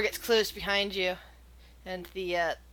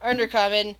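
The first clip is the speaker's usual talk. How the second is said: usual speaking voice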